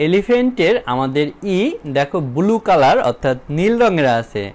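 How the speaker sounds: ordinary talk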